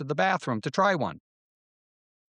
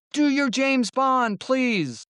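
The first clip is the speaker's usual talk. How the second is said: put-on high, pleading kid voice, slowed; begging kids; 11 semitones higher, 3 dB louder, much slower than usual. Classmates beg the narrator for his Bond, high pleading. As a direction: mimic classmates begging eagerly. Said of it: "They would come up to me and beg".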